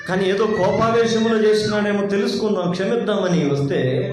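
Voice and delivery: ordinary talk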